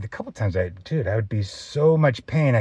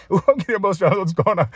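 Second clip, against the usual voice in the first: tearful laugh; 4 semitones higher than usual. Real, not acted. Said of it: "And I'm crying right now".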